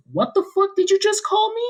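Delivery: high indignant shriek; a high outraged Karen voice. Retold or imitated. Offended Karen shrieks at the teen who insulted her.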